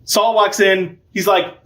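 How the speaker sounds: usual speaking voice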